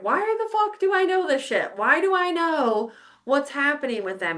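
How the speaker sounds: retold disbelief, pitched high then easing down; bewildered exclamation. Woman recounting unexplained knowledge at work, bewildered. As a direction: act out exasperated bafflement at yourself. Retells own bewilderment at knowing things.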